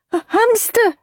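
high, timid squeak; nervous little voice; anxious boy. Acted. Andrew nervously offering his guess, timid. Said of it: "said Anxious Andrew".

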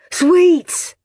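high eager shout. Acted. Graham shouting guess at prize, eager shout. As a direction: blurt it out greedily. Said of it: "shouted Greedy Graham".

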